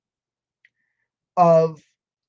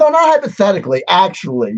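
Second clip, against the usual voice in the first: loud correction; 2 semitones higher, 10 dB louder, faster than usual. Real, not acted. Host overriding Peterson's hypothetically, fast and loud. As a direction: cut in and shout him down.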